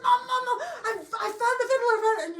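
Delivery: high, loud, tearful yelling, fast; girl bursts home yelling to her mother; excited crying shout. Acted. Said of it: "she was so excited".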